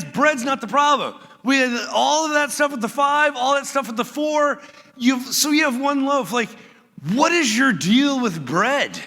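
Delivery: high, loud exasperated paraphrase; flustered Jesus scolding disciples over bread. Retold or imitated. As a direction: throw up your hands at them. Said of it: "he's flustered with them".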